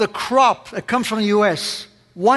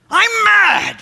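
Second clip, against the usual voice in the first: much higher, louder mock-angry boom; Tony Robbins big voice pushed further; 12 semitones higher, 4 dB louder than usual. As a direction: crank the guru anger right up to the top. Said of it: "that big voice of his".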